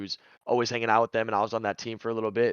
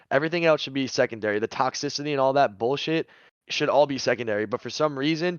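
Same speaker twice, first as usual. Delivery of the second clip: ordinary talk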